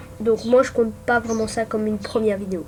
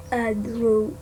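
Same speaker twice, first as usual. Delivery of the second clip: mock English accent; 4 dB quieter, much slower than usual. Shown as a demonstration. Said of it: "Avec mon magnifique accent".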